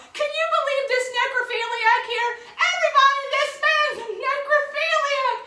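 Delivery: urgent call to someone far away; yelling across the square. Retold or imitated. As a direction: bellow it across a crowded plaza. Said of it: "He continues to yell".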